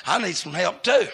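punchy revival-preacher cadence; forceful preaching. Real, not acted.

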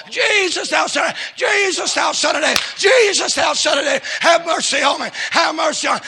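high, insistent repeated cries. Acted. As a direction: beg for mercy at a shout. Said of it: "He cried out the more".

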